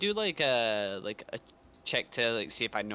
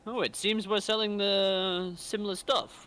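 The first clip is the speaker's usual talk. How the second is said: character voice; 7 semitones higher, slower than usual. Acted. Player in character.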